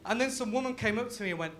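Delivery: ordinary delivery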